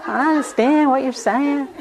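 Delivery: put-on high, odd foreign-sounding accent on I understand; weird accent. Kittisaro piping up in the teacher's support, weird accent. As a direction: put on a strange, unplaceable accent, a little high. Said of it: "weird accent".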